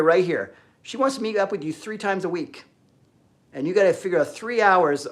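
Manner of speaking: ordinary delivery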